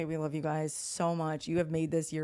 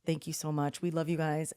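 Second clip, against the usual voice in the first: ordinary talk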